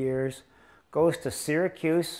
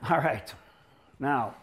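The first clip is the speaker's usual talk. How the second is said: usual speaking voice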